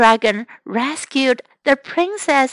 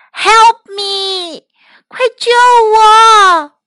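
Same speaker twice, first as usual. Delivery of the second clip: high desperate call for help; cry for help; 9 semitones higher, 6 dB louder, slower than usual. Acted. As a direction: scream for rescue. Princess calls for help.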